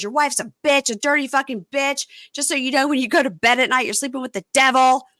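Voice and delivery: acted angry insult. Retelling Danielle cursing Bill's wife, mock anger.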